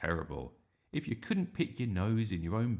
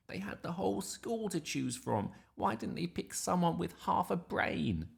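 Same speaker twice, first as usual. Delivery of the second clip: sister's voice; 12 semitones higher than usual. Acted. Susie mocking brother.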